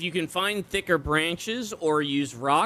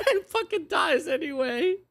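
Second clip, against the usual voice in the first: high laugh through the words; laughing disbelief; 11 semitones higher than usual. Real, not acted. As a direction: laugh as you say it.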